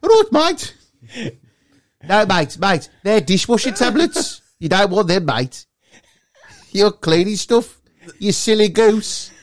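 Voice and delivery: mock West Midlands mate voice. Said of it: "they're all talking funny".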